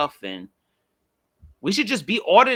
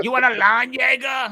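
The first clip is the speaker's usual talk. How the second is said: mock German; 8 semitones higher than usual. Mocking a German-speaking stranger.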